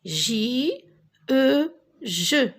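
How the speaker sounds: J, E, then blended into "je"; teacher modelling "je" with pauses; spelling aloud. Shown as a demonstration. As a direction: sound out J and E separately, then say "je". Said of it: "est-ce que tu peux répéter".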